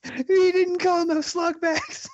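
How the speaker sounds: high, slow shrieking quote; hammed-up high-pitched wail; mock screaming girl. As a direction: shriek it like the Sin City girl. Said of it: "screaming like that one girl".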